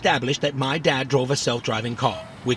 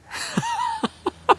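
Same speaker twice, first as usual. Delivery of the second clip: laugh. Acted. Robot laughs after denial.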